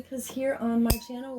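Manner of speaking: usual speaking voice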